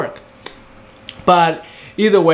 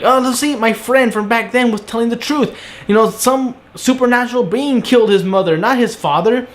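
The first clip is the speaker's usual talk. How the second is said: mocking Iris; 7 semitones higher than usual. Mocking Iris's blog reasoning.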